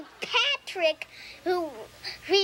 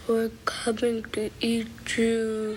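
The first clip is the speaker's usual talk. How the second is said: eerie whispered ghost voice; 5 semitones lower than usual. Retold or imitated. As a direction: whisper it like a ghost coming for you. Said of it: "it says".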